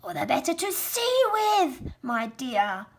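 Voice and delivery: high put-on old lady voice; wolf faking a sweet granny; wolf's granny voice. Wolf pretending to be granny to the girl, high. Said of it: "in his granny voice".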